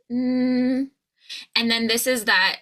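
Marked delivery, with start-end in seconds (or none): 0.1-0.9 "N" as a held nasal hum; N sound. Shown as a demonstration. Coach models the N, held as a hum. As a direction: hum "N" with tongue on the ridge. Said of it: "tongue is up for the N".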